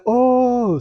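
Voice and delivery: high sudden "Oh" of realization; realizing oh. Retold or imitated. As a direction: pop the "Oh" up high, as if it just clicked. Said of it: "I was like".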